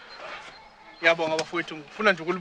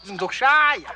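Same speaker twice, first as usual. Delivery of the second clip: loud, higher threat to hit; angry threat; 7 semitones higher, 5 dB louder than usual. Acted. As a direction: snap it as a threat.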